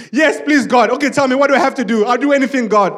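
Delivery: high, loud, rushed begging; hammed-up desperate agreement; frantic pleading. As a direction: plead eagerly, tripping over the promises. Jack begs God for rescue.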